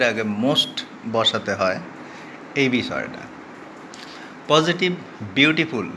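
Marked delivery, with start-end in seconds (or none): none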